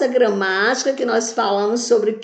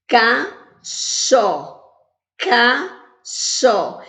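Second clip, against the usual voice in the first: "Cachó" in two long, separate beats; syllable by syllable; much slower than usual. Shown as a demonstration. Teacher counting syllables aloud, "Cachó" split in two. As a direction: pause between ca and chó. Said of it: "Eu falei quantas vezes?".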